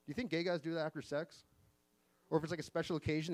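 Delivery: normal talking voice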